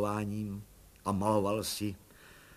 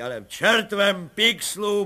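louder, slower happy roar; blissful boom; 9 semitones higher, 7 dB louder, slower than usual. A narrator's reading. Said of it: "burácel blaženě plukovník".

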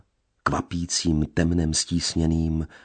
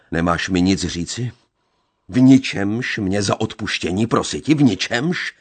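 loud, higher, insistent demand; angry interrogation; 4 semitones higher, 6 dB louder than usual. A narrator's reading. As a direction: pin her down, demanding an answer.

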